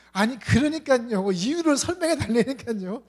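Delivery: high, loud insistent youth; insistent whine. Retold or imitated. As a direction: press for the reason, exasperated. Young man insists again.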